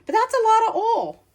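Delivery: high, loud exclamation of surprise; shocked at how much came out; surprised. Real, not acted. Too much oil poured out.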